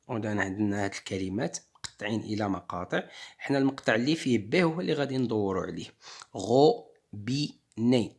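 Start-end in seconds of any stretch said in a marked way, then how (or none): 6.3-8.2 very slow, each syllable split apart; teacher sounding out a word. Shown as a demonstration. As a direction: break the word into syllables for the class. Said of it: "Donc le son B".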